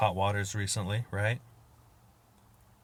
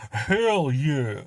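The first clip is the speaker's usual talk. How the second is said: louder, higher and pumped up; a big proud whoop; excited cheer; 5 semitones higher, 6 dB louder than usual. Retold or imitated. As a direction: belt it out like a celebration. Trying out West African pride.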